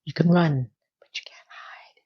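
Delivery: low whisper fading to almost nothing; teasing whisper. Pretend. Recapper taunts Charlotte for pretending, playful whisper. Teasing the character who denies it.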